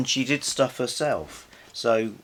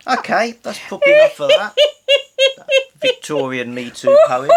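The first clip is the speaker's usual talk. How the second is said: much higher and louder, words swallowed by laughter; laughing hard; 13 dB louder than usual. Real, not acted. Host laughing as she ends the poem talk. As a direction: crack up while trying to wrap up.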